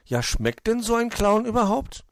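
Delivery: high, loud naive question; puzzled little hedgehog. Acted. Borstel wonders about eating clowns, puzzled and high. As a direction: ask in wide-eyed wonder. Baffled Borstel keeps asking.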